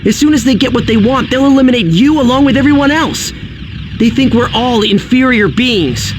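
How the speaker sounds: heated, emphatic warning to an enemy; urgent warning. Acted. Hero urgently warns the villain.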